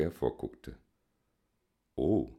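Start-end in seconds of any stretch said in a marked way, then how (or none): none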